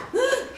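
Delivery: high sharp gasp of shock. Retold or imitated. Teacher imitating a startled student's high gasp. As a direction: gasp like the girl who saw the monster.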